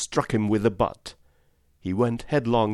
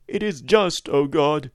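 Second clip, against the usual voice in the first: high, raised voice of a praying curate; fervent curate; 7 semitones higher, 6 dB louder than usual. Acted. Curate praying aloud in hiding, high fervent lament. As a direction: cry out to God, pitched high. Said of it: "he would say over and over again".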